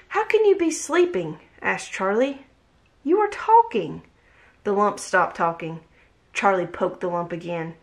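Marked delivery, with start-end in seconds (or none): 0.1-1.4 much higher, disbelieving question; incredulous child character. A narrator's reading. Charlie challenges the lump. 3.0-4.0 high-pitched pointed protest; insistent child character. A narrator's reading. Charlie challenges the lump.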